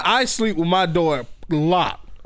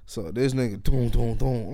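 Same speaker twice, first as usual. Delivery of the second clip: usual speaking voice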